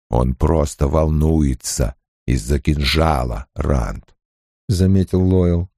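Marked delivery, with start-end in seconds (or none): 0.1-4.1 low, rumbling gentle giant; deep Ogier voice. A narrator's reading. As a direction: speak low and slow like a huge Ogier. Ogier Loyl voiced by narrator.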